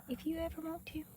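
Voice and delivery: whispered reassurance; whispering. Real, not acted. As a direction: whisper it gently to the animal. Whispering to animal.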